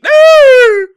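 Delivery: a sudden shrieking laugh at a bad play; screaming laugh. Real, not acted. Furious fan shrieks with laughter at the TV. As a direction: let out a shrieking cackle.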